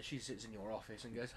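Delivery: ordinary talk, nothing marked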